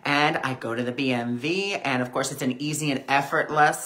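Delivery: ordinary delivery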